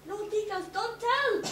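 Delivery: usual speaking voice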